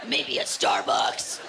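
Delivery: very low, slow, harsh caricature; comic head voice. Pretend. Woman imagining a coffee date, lapsing into crude head voice. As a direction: drop suddenly into a slow, crude put-on voice.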